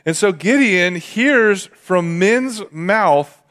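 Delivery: ordinary delivery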